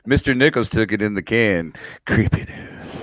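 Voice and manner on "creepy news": whispered spooky aside; a mock eerie whisper; creepy whisper. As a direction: breathe it like a ghost story. Spooky tag line joke.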